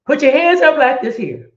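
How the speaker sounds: high, loud call to raise hands; loud instruction. Shown as a demonstration. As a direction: call it out to the whole room. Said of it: "Yesterday I told y'all".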